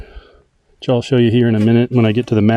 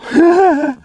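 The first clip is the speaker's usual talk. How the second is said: short high laugh. Real, not acted. Laugh after pointing something out.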